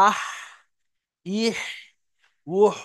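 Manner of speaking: আহ, ইহ, উহ each ending in air from mid-throat; airy ha demo. Shown as a demonstration. Teacher demonstrates ha with three vowels for students. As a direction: separate আহ, ইহ, উহ with a breathed-out throat h. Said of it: "গলার মধ্যে হাওয়াটা কিছু বেরিয়ে আসে".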